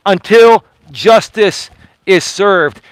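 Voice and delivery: slow, each word weighed; a slow, firm echo of the vow; slow deliberate repeat. Real, not acted. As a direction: drag it out so it lands.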